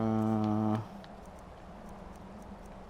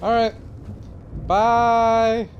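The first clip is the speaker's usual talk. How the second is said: cheery sing-song goodbye; 11 semitones higher, 5 dB louder, much slower than usual. Pretend.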